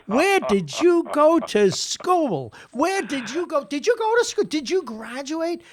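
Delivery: high, loud exasperated coach tirade; mocking Brooklyn coach rant; yelling coach. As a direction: berate the player like an old-school coach. Coach yelling at hockey player.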